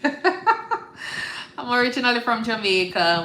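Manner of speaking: amused, drawn-out laugh-talk. Real, not acted. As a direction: chuckle while repeating it. Laughing repeat of intro line.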